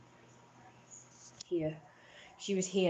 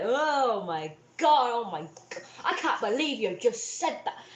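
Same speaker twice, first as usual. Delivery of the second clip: mocking mum; 4 semitones higher, 3 dB louder than usual. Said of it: "she was like".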